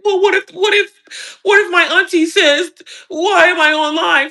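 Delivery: high, slow, fake-weepy whimper; mock crying whine. Mocking a scared follower, a high fake-sob whine. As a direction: play the scared follower near tears.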